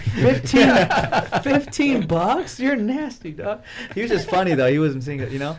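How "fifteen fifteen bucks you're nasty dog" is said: much higher, slow, drawn-out incredulous laugh; mock disbelief. Teasing a friend over a bet, high mock disbelief. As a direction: stretch out fifteen in fake shock. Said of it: "I was like".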